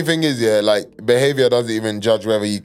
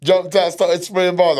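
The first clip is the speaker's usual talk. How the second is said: high-pitched, animated reminiscing; excited recall; 10 semitones higher, 3 dB louder than usual. Real, not acted. Host reminding friends of a legendary rap set, excited. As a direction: get carried away remembering it, pitched right up.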